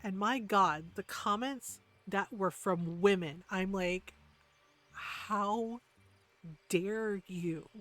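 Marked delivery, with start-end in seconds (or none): 4.9-7.7 slow, halting indignant rebuke; appalled, with pauses between the words. Retold or imitated. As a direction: space out each word in outrage. Indignant rebuke to mean commenters.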